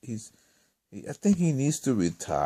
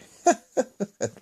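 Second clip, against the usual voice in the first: laughing; 10 semitones higher than usual. Real, not acted. Laughs at his miscount.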